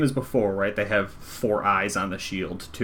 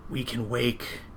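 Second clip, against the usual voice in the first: hollow voice. Acted. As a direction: speak hollow and distant, like an echo. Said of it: "they ask in a hollow voice".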